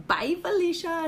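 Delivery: put-on the girl tossing off "Bye, Felicia"; sassy brush-off voice; sassy dismissal. Shown as a demonstration. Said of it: "two different cultural references".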